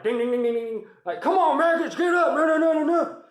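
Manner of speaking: high, loud barracks wake-up yell; drill sergeant yelling. Retold or imitated. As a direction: bellow it like a Full Metal Jacket drill sergeant. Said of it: "yelling like it's fucking, uh, Full Metal Jacket".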